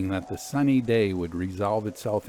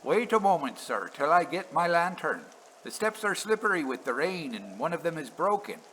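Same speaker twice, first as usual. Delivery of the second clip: higher, polite calling down; a hint of a helpful railwayman; lightly voiced signalman; 6 semitones higher than usual. A narrator's reading. Signalman calling down while fetching a lantern, higher.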